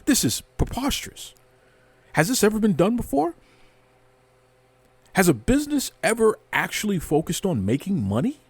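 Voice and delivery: high, sarcastic mock shock; mock outrage. Podcaster mocking wrestling critics, sarcastic mock outrage. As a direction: play the scandalized critic, dripping sarcasm.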